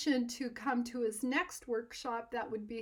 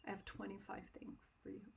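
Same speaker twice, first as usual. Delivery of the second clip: whispered, much softer and a little lower; quiet mentor; 3 semitones lower, 14 dB quieter than usual. Retold or imitated. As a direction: hush it like a calm teacher giving news. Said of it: "And he's really quiet".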